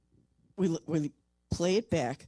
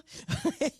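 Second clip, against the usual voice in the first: short high-pitched laugh; cracking up at herself; high giggle. Real, not acted. Guest giggling at her own quote.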